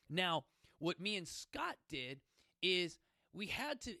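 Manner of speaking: ordinary delivery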